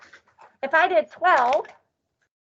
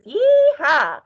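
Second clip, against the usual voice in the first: loud cowgirl whoop; cowgirl yeehaw; 3 semitones higher, 6 dB louder than usual. Acted. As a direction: holler it like a cowgirl. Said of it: "it's the cowgirl fours".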